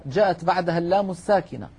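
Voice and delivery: normal talking voice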